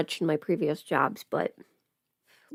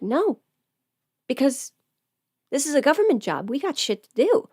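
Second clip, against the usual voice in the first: coworkers' voice; 5 semitones higher than usual. Retold or imitated. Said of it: "And they're like".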